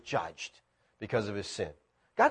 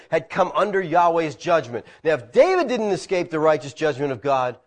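ordinary delivery

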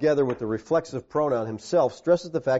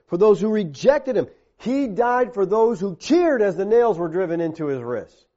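higher, insistent sermon cadence; forceful preaching; 5 semitones higher, 4 dB louder than usual. Real, not acted. Preacher recounts whom Christ died for, impassioned and forceful. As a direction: hammer out each parallel phrase with raised pitch. Preaches in mounting repeated phrases.

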